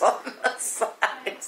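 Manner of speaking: slowed, amused laughing delivery. Real, not acted. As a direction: laugh through the last words. Amused at remembered outfit.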